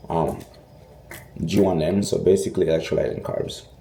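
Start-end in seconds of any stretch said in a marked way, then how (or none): none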